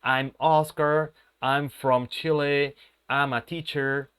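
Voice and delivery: slow, carefully spaced classroom example; slow example sentence. Shown as a demonstration. Teacher modelling a sentence for the class, slowly. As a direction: spell out each phrase for students, unhurried. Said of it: "Ahí yo puedo perfectamente decir".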